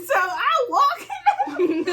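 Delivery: laughing through the words, high and slow. Real, not acted. Telling friends the story, laughing hard. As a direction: barely get it out through laughter.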